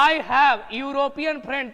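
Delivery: each word read out slowly; slow dictation read. Shown as a demonstration. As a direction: read the sentence slowly for the class. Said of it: "तो European friend". (वाक्य को धीरे-धीरे पढ़कर सुनाना)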